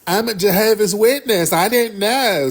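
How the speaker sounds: mock-innocent caller, pitched high; mocking imitation. Mockingly quotes the woman caller.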